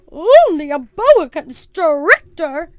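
loud, slow, high disbelief; carrying outraged voice. A narrator's reading. Missus Perkins over the noise, loudly incredulous. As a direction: boom it incredulously, drawing out each word. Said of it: "voice carried over the noise".

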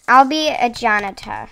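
playful mock British accent. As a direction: put on a loud British voice. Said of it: "British accent".

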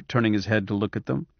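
plain reading voice

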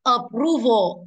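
apPROval, loud and drawn out on PRO; stress on second syllable. Shown as a demonstration. Teacher showing the class word stress, PRO punched in Approval. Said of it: "rơi vào sau nó và từ prove".

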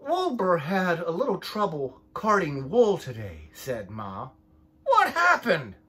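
lively picture-book read-aloud, voices for each sheep; reading to children. A narrator's reading. Parent reading a sheep story to the child, lively storytime voice. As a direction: read it like storytime for little ones.

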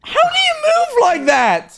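high, slow, loud disbelief; incredulous shout. Real, not acted. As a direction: shriek it out in total disbelief. Baffled by the dancer's walk.

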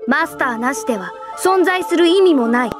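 firm, forceful admonishment; stern lecture. Acted. Superior reminding subordinates of their duty, stern. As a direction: drive the duty home firmly.

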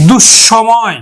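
দুঃসময় as dush-shomoy; doubled palatal sh. Shown as a demonstration. Teacher giving a further example, doubled sh in দুঃসময়. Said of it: "দুইবার উচ্চারণ করব".

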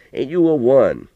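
lightly voiced old man, chuckling tease; a hint of a rough country grandpa; old grandpa teasing. A narrator's reading. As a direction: rib the boy like a fond old coot.

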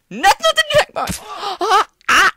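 high, loud outraged cry; real outraged yelp; shocked shriek. Real, not acted. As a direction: shriek at the bad result. Gamer shrieks at wrong result.

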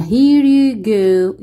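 drawn-out question for the class; slow it down word by word; very slow model reading. Shown as a demonstration. Teacher as flight attendant, slow model question. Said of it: "واحد بيكون هو المضيف للطيران".

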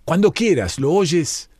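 calling out. A narrator's reading. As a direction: call back to the boy as you walk away. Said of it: "volviéndose repetidas veces para gritarle".

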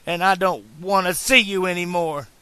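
loud, high, furious rebuke; angry scolding. A narrator's reading. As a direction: tear into him, voice climbing. Clara berates Doc.

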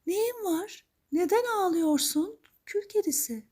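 very high-pitched fairy godmother; high, lilting magical lady; woman's mysterious voice. Acted. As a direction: ask with concern in a high storybook voice. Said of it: "diye sormuş bir kadın sesi".

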